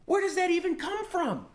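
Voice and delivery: high, squeaky disbelief; baffled at the reveal; mock shock; astonished. Shocked realization in retold fight.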